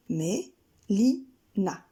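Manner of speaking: Mé-li-na stretched very slowly in three beats; walk out Mé-li-na step by step; slow syllables. Shown as a demonstration. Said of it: "Trois syllabes, trois pas".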